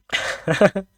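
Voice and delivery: sudden high, loud burst of laughter. Real, not acted. Streamer cracking up at chat, loud laugh.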